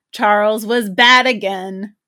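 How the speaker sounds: loud, slow calling. Acted. Boy shouting his news across the hill, loud.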